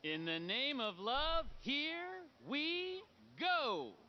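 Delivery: drawn-out showman's announcement; rising, theatrical barker call; carnival barker. Acted. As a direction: stretch it out to launch the contest.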